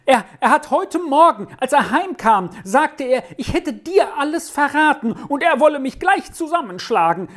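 put-on high-pitched, agitated boy's outpouring; screeching boy. A narrator's reading. Boy fearing father's beating tonight, agitated high screech. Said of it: "kreischte der eine".

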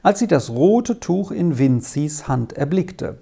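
plain reading voice

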